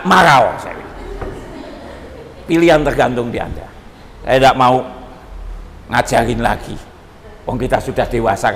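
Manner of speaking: ordinary delivery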